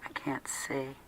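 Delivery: hushed aside, hardly any voice; barely audible. Real, not acted. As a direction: let the words almost vanish into breath. Tearful host struggling to read on.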